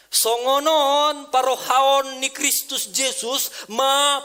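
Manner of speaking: high, loud, slow recitation; slow scripture reading. Acted. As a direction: proclaim the verse to the congregation. Reads verse in other languages.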